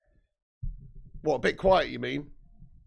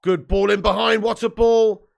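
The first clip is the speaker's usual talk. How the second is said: excited commentary; 6 semitones higher, 10 dB louder than usual. Acted. Commentary on a through ball.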